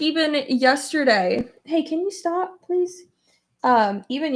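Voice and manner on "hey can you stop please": high, pleading aside to someone nearby; high called-out plea. Real, not acted. To someone interrupting, a high pleading aside. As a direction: ask them to stop, pitched up. Tells someone off-mic to stop.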